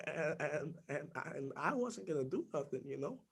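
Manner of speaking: put-on teen admitting he held back, hushed. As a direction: barely get it out. Ashamed after the frenzy.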